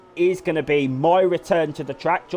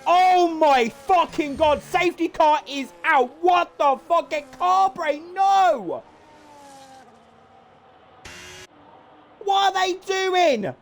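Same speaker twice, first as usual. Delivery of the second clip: high, slow, stunned exclaiming; drawn out in shock; stunned disbelief; 12 semitones higher, slower than usual. Real, not acted. Crash, safety car, disbelief.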